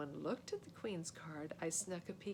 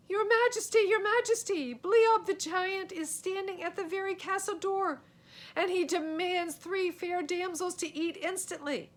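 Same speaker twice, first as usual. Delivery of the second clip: much higher, much louder urgent alarm; frantic messenger shout; 12 semitones higher, 10 dB louder than usual. Acted. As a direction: burst into the hall crying the news. Messenger bursts in with news.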